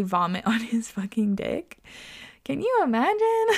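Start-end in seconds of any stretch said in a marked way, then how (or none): 2.4-3.6 high-pitched squeal breaking into laughter; incredulous laughter. Real, not acted. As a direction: squeal it in real disbelief, then crack up. Laughing in disbelief at story.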